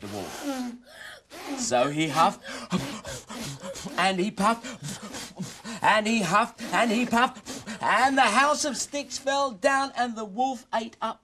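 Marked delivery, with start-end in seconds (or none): 1.6-11.2 rising higher and louder; building excitement. Acted. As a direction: build the huffing to a climax. Narration builds huffing.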